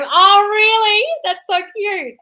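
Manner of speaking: high, loud, slow gasp of delight; delighted squeal. Real, not acted. Host squealing at a viewer's cute story, delighted. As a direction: coo over the cute news.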